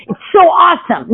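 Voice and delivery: high-pitched gushing delight; hammed-up thrilled exclamation; mock enthusiasm. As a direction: gush it with exaggerated joy. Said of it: "They're not going".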